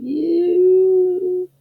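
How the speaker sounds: high loud disgusted squeal; hog squeal. Acted. Sprayed hog squealing at the sneeze, loud squeal. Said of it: "who screamed".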